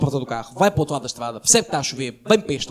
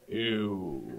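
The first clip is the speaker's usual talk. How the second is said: sheepish small voice; 8 semitones lower, 10 dB quieter than usual. Retold or imitated. Girlfriend admitting, small voice.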